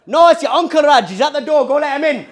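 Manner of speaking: high, loud Geordie-Indian dad; Geordie-Indian accent. Retold or imitated. Dad sending son to the door, loud Geordie-Indian accent. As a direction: holler in mixed Geordie and Indian. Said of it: "It's a hybrid between Geordie and Indian".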